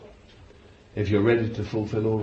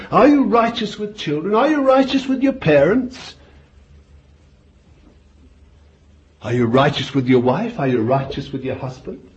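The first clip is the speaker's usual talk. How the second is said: rising, louder and higher with each question; preaching build; 7 semitones higher, 6 dB louder than usual. Real, not acted. Preacher drilling his flock with questions, building to a cry. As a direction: press the congregation harder every line.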